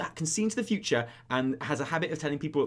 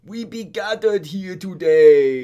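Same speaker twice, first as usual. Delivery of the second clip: orc officiant; 3 semitones higher, 13 dB louder, much slower than usual. Retold or imitated.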